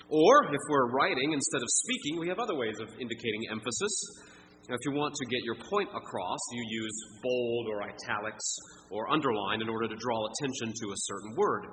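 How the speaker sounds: ordinary delivery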